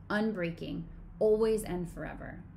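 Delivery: ordinary talk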